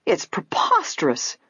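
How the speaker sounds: slow, drawn-out outrage; storming. A narrator's reading. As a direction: storm it, stretching the word out. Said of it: "Missus Topham stormed".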